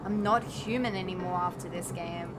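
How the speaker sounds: low, quiet, mock hollow voice; deadpan despair. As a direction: sound drained and empty. Mock despair after losing.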